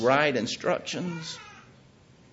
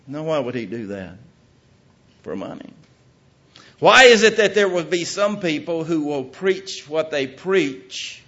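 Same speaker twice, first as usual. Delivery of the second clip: ordinary talk, nothing marked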